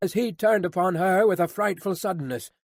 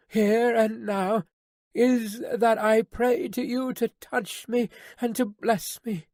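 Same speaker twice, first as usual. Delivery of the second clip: put-on higher, earnest, tearful young woman; pleading young daughter; 6 semitones higher than usual. A narrator's reading. Daughter pleading to her father, higher and earnest. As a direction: beg him with all your love.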